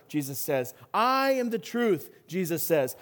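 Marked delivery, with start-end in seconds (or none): 0.9-2.1 "I" punched high and drawn out; slow proclamation leaning on "I"; emphatic declaration. Retold or imitated. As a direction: hold the "I" high, then land "truth". Continues the "I am" sayings.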